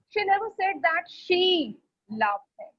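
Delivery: second she held and lifted, then a pause; stress on second she. Shown as a demonstration. Teacher showing focus on the pronoun, stress on second she. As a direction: lift the later "she" after "that". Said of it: "I'm focusing on this pronoun".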